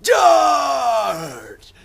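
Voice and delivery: high, drawn-out hammed-up war shout; battle cry. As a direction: bellow a mock cavalry charge. Mock battle cry of three boys.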